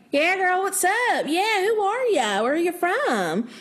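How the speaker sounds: imitating a Southern drawl, high and friendly; a light mock Southern greeting; hammed-up Southern small-town accent. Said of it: "from people talking like this".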